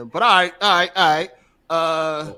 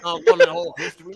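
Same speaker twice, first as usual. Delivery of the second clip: mocking quote; 12 semitones higher, 4 dB louder than usual. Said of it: "He said".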